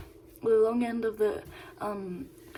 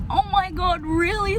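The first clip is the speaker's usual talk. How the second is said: high-pitched, thrilled reaction; delighted disbelief; 9 semitones higher than usual. Retold or imitated. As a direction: retell being flattered, pitched well up. Delighted reaction to praise retold.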